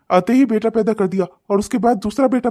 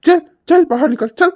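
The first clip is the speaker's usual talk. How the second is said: put-on loud furious yelling at the crying girl; angry shouting; 3 dB louder than usual. Father yelling at his little daughter to get out, furious. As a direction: bark at her to get out.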